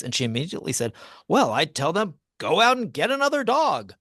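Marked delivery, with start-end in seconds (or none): 1.3-3.9 high, loud, imitated exclamation; emphatic retold advice. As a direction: blurt out the punchline as her answer. Acted punchline of Edna's immediate answer.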